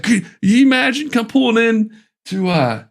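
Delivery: high, loud Southern camp comic; Southern accent. Acted. Campground comic opens his act in a Southern accent. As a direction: pitch it way up in a hammed-up Southern accent. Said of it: "has to have a Southern accent".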